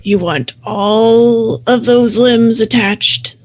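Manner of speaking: slow, gruff werewolf taunt. Acted. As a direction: draw the words out menacingly in a gruff voice. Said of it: "in a gruff voice".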